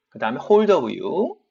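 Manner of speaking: ordinary talk, nothing marked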